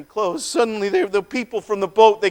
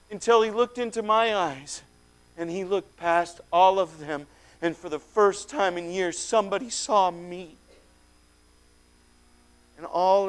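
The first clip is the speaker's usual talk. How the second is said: soft and gentle, dropping much quieter; tender hush; 4 semitones lower, 9 dB quieter than usual. A narrator's reading. The man recalls being truly seen, tender and hushed. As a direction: let the voice melt into tenderness.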